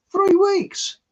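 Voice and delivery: high, loud disbelieving cry; can you believe it, three weeks; amazed exclaim. Real, not acted. To listeners, amazed how long seeds took to sprout.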